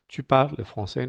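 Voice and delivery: French "tu parles français" with silent endings; correct silent ES. Shown as a demonstration. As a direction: model "parles" as "parl". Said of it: "no decimos".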